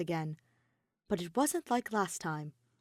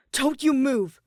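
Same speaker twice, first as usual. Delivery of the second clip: snapped warning, higher and louder; threatening order; sharp command; 6 semitones higher, 11 dB louder, slower than usual. A narrator's reading. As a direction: bark it to freeze him. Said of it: "Shuichi snapped at Kokichi".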